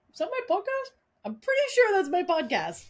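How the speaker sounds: high, startled disbelief; surprised. Retold or imitated. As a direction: squeak it out in shock. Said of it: "I was like".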